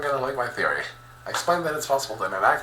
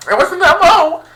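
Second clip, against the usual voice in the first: loud, high-pitched yelp; yelled in high-pitched disbelief; shocked outcry; 16 dB louder than usual. Acted. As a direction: blurt it out way up high at full volume. Shocked outcry at the empathy stat.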